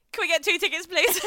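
high, loud panicked blurt. Retold or imitated. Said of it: "just pure panicked".

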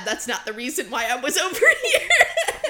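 loud acted excuse breaking into laughter; laughing retold protest. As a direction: start the mock explanation, then crack up. Acted flustered apology breaks into laughter.

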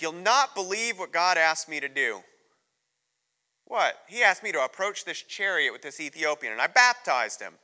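loud, breathless big news; can't wait to tell them; excited Philip. Retold or imitated. Philip voiced telling the brethren.

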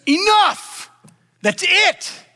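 high, loud, fed-up outburst; acted exasperated shout; shouting with attitude. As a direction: yell it like a parent at the end of their rope. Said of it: "attitude sometimes".

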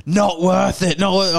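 high-pitched mock yell; retold yell. Mate yelling back from around the bend, high mock yell. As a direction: imitate a mate hollering a parting shot. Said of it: "what's the point of yelling that out".

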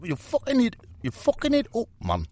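put-on high Geordie accent; mock Geordie. Geordie accent telling the friend off. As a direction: Geordie scolding someone for messing it up. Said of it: "the Geordie one".

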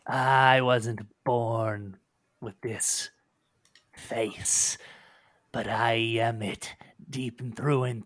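slow, brooding wolf-woman pirate; feral werewolf woman. Acted. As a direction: drag it out with grave menace.

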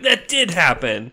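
normal talking voice

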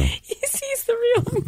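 high, slow, lovestruck voice; swooning fan. Pretend. Mock fan swooning over Costner, high and slow. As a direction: melt like a smitten fan.

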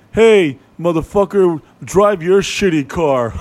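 ordering his friend to drive in a mild mock swagger; cocky friend. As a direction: boss him around like a smug buddy. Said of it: "no prep".

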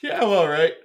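yawned out like a cartoon bear; yawning cartoon bear. Real, not acted. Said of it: "You said it like a cartoon bear".

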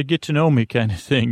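ordinary talk, nothing marked

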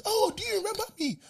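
high-pitched excited auntie greeting; imitating gushing auntie. Aunties greeting a child they knew, mock high-pitched gushing. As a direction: coo it like an auntie spotting a relative. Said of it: "I was seeing bare aunties".

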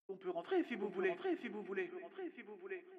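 put-on high child's voice echoing away. Tunnel echo mocking the boy's last words, fading repeats. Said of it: "Un écho s'empara de ces derniers mots et s'amusa avec".

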